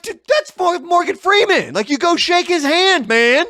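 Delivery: high, loud, insistent disbelief; retelling his own excited urging to a friend; incredulous urging. Retold or imitated. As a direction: push him like it's obviously a huge deal. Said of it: "And I'm like".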